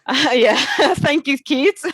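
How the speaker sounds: amused laugh breaking into a thank you; real giggles. Real, not acted. Laughing at kids' remark.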